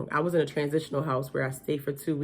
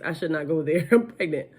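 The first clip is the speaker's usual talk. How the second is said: normal talking voice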